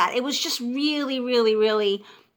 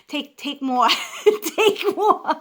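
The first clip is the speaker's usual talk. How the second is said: laughing mock urging; 6 semitones higher, 5 dB louder, much slower than usual. Guard pushing tissues on a crying woman, laughingly retold. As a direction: laugh through it, jumping higher and louder.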